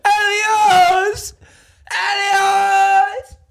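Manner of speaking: high, loud mock desperate shout; hammed-up scream across the arena; calling out Elios. Captive friend shouting for Elios, high loud cry.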